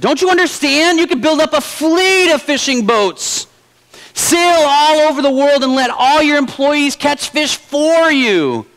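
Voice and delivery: high, pushy, exasperated; an impatient businessman pressing his point; businessman getting angry. Acted. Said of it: "the businessman started to get a little angry".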